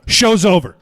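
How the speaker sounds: abrupt, loud declaration; retold curt sign-off; loud announcement. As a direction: shut it down for the whole room. Announcing the end to crowd.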